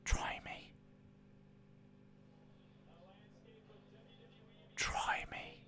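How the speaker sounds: voiceless, menacing mock whisper; whispered dare. Streamer daring chat to get blocked, ominous whisper. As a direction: dare them under your breath.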